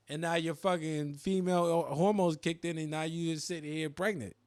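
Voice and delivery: normal talking voice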